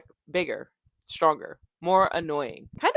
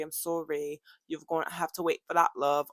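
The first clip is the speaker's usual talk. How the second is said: a hint of a British-Scottish accent; a lightly put-on British lilt, ending on love. Vlogger teasing viewers in a faint British accent. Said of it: "I don't know what accent I just did".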